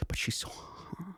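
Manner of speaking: whispered confession. Real, not acted. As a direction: breathe it out secretly. Confessing attraction under breath.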